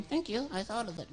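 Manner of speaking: high, soft puppet voice; squeaky little sock voice. Acted.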